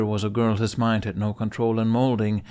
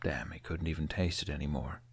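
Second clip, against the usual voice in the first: ordinary delivery, nothing marked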